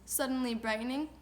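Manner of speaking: ordinary delivery, nothing marked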